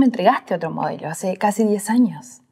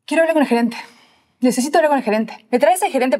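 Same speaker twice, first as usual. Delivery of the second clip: raised, higher-pitched demand; clienta indignada; 6 semitones higher, faster than usual. Acted. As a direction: insist like a fed-up customer calling for the boss. Irate customer demands the manager. (reclamo indignado, con la voz más aguda)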